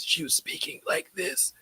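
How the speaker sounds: breathy voiceless whisper; imitating a lost voice. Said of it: "she had lost her voice".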